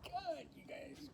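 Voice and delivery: high, soft flustered apology; a hushed, mortified apology; embarrassed. Real, not acted. Apologizing to viewers for a wardrobe slip, soft and flustered.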